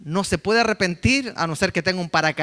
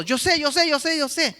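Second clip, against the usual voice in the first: higher, whiny "yo sé" repeated; know-it-all kid; 9 semitones higher than usual. Retold or imitated. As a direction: mimic a kid cutting off a parent. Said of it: "Ellos lo saben todo".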